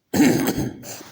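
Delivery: a sudden, sharp dry cough; a cough that breaks into the lesson; real cough. Real, not acted. Teacher coughing mid-lesson, a real dry cough. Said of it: "अ, sorry".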